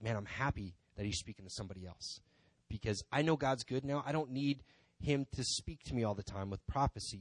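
usual speaking voice

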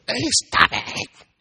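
high, slow, squealing coworker; drawn-out high yelp of protest; mock protest. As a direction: play the tickled coworker crying out. Coworker protests being tickled.

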